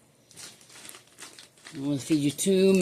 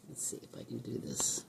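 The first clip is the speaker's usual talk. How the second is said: unvoiced hushed whisper; 5 semitones lower, 17 dB quieter than usual. Real, not acted. Owner whispering while creeping closer with the camera. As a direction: whisper so the bird stays calm.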